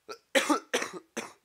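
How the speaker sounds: loud, repeated real coughs; coughing fit. Real, not acted. Gamer with the flu breaks off, coughing hard. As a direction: cough hard several times.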